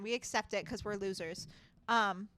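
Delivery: normal talking voice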